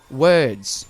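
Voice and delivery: Australian "words" with the R dropped; Australian accent. Shown as a demonstration. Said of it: "an Australian would say".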